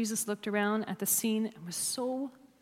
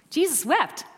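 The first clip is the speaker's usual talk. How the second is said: put-on higher, louder child's answer; young girl reciting; 6 semitones higher, 7 dB louder than usual. Young girl answers her Sunday school teacher, piping up. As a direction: pipe up like a keen Sunday school pupil. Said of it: "When I was a young girl".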